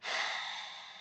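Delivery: soft, audible sigh; breath out. Shown as a demonstration. Therapist pausing while images come, soft sigh. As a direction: exhale softly into the pause.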